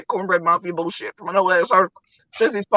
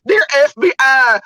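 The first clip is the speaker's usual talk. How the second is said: mock shout; 4 semitones higher, 7 dB louder, slower than usual.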